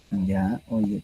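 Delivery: ordinary talk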